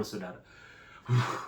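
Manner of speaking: ordinary delivery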